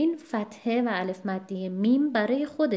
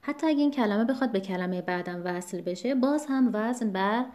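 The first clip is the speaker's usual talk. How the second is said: usual speaking voice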